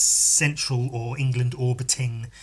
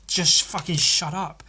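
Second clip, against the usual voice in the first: slow, fed-up snap; mock angry retort; 4 semitones higher than usual. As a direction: tell them to shut up, deliberately and slowly. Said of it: "I wanna tell people".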